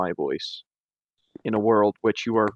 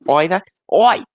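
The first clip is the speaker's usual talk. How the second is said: cheeky cockney "Oi!", higher and louder; British paper boy voice; 6 semitones higher, 6 dB louder than usual. Pretend. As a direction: shout it like a London newsboy. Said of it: "[name] is doing an impression of a British paper boy".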